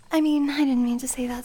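quick embarrassed whisper; flustered whisper. Acted. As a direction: rush it out under your breath.

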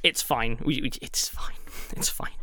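low, soft, fading to a whisper. Real, not acted. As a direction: reassure yourself, voice dying away. Unconvincingly reassuring the others, fading.

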